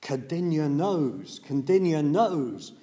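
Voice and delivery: loud, slow, ringing declaration; the Buddha shouting out his joy that a disciple understood; emphatic proclamation. Retold or imitated. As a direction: proclaim it slowly to the whole room, full voice. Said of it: "traditionally shouted out".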